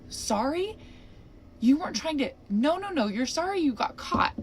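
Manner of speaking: outraged mock reply; indignant retort. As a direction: throw his apology back at him.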